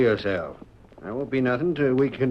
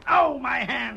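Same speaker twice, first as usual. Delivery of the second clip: cry of pain; 9 semitones higher, slower than usual. Acted. Outlaw shot in the hand.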